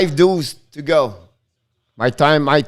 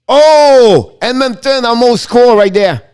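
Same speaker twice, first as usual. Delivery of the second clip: alarmed shout; 5 semitones higher, 8 dB louder than usual. Real, not acted. Near goal in live call.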